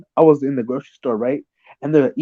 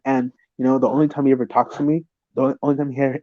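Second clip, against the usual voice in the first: ordinary talk, nothing marked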